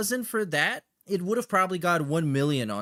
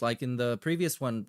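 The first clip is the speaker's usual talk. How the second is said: ordinary delivery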